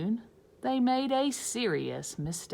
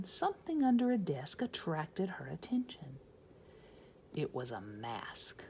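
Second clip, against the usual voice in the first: whispered suspense; 3 semitones lower, 7 dB quieter than usual. A narrator's reading. Narrator building suspense, whispered.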